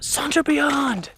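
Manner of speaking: high, drawn-out holler; imitated far-side-of-the-road yell; mock yell. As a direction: call it out long and high, imitating a shout from across the road. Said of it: "he just yells out".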